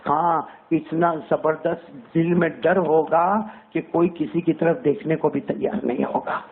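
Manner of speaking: ordinary talk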